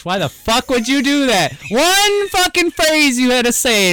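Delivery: high, exasperated rant rising in pitch; worked-up, heated complaint; heated outburst. Real, not acted. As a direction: demand an explanation, pitch climbing in outrage. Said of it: "now I feel heated".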